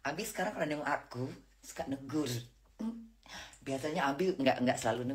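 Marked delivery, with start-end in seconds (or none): none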